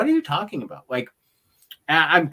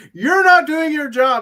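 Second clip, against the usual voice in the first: much higher and louder indignant scold; mock scolding; 11 semitones higher, 10 dB louder than usual. Fan imitating Asuka scolding the man, high mock outrage. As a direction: tell him off like a furious diva. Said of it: "'cause she's like".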